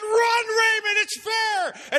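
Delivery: high, loud shout to run; the umpire shouting at Raymond to run; urgent shout. Retold or imitated. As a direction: yell at the batter to go. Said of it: "I said".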